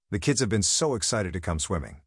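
ordinary delivery

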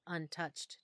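plain reading voice

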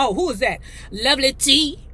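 normal talking voice

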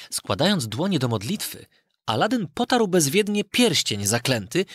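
usual speaking voice

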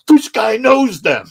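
loud, high, slow amazed realization; awed crowd. Retold or imitated. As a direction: gasp it like an impressed fan. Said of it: "the crowd would be like".

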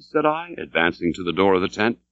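ordinary delivery, nothing marked

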